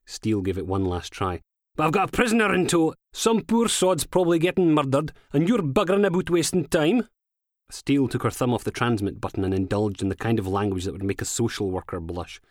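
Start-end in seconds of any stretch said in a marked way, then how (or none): 1.8-7.1 higher, louder, fed-up Scots woman; Steele's exasperated protest. A narrator's reading. Steele protests to control, exasperated and raised. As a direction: complain down the radio, exasperated. Steele arguing over the radio.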